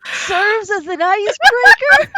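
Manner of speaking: high, loud, slow disbelief; incredulous. Acted. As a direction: stretch out the outrage. Incredulous at teasing girlfriend.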